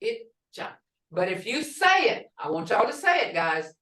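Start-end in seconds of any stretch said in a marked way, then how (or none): 0.0-0.7 Itja with the D barely there; Itja, D almost silent; Muskogee word. Shown as a demonstration. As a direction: say Itja with no audible D. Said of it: "You don't hear the D".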